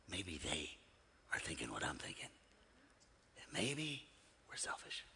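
dropping to a soft whisper; hushed whisper. Acted. Preacher confiding to the church in a near whisper. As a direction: let it fall to a secret whisper.

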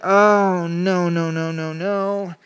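slowing, fading string of no's; dismayed no's. Retold or imitated. Preacher recalling his guilt on the plane, slow and dismayed. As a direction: let the dread sink in, word by word. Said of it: "I'm like".

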